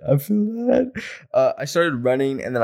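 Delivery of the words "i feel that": "that" sung out much higher; high-pitched repeat. Shown as a demonstration. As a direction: say "I feel that" again, way up high.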